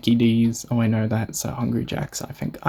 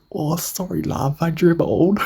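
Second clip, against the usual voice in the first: bad British accent, higher pitch; imitating a sleepy Brit apologising; 6 semitones higher than usual. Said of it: "I can't do a British accent for shit".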